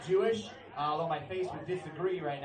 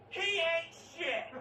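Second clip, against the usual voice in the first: imitated scream; 11 semitones higher, much slower than usual. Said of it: "she screamed out at the top of her lungs".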